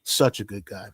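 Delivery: low, heavy-hearted recollection; subdued grief. Real, not acted. Recalls friend's sudden death.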